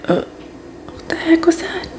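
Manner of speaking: shocked whisper, breathy and hushed; retold whisper. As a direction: whisper it as if stunned by sudden pain. Retold shock over sudden pain.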